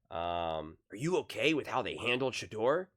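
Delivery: normal talking voice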